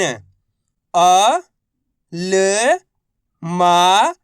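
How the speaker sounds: अ, ल, मा each alone, held and rising; letter-by-letter drill. Shown as a demonstration. Teacher for students to repeat, अ, ल, मा drawn out singly. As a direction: sound out अ, ल, मा with long gaps between. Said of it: "एक अक्षर का उच्चारण".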